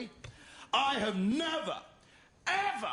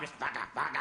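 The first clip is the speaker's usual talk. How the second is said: inner brute voice; 9 semitones lower, faster than usual. Retold or imitated. Voicing his inner nasty fucker.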